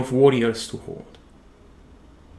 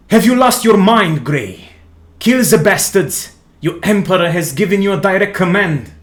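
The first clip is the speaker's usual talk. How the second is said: much higher and louder, scornful shouting; angry knight; 5 semitones higher, 4 dB louder than usual. Acted. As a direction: snap at him, then rush the command.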